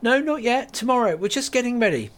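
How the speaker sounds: polite and busy; Kate voiced, bright. Kate tells the customer the shop opens tomorrow, friendly.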